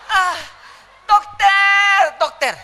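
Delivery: high, loud cry for help; desperate calling out. Acted. Labouring mother to doctor, desperate cry. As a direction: stretch the word in a hammed-up shout.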